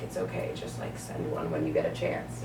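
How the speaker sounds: softly whispered, unbothered patience; calm quiet customer. Retold or imitated. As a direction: breathe it out gently like a patient regular. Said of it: "the people that were calmly there".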